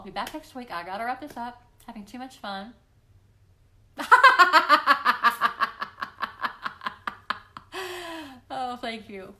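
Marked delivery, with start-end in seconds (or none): 3.7-8.5 long loud high laugh; a real gleeful laugh; big laugh. Real, not acted. Laughs at having fun.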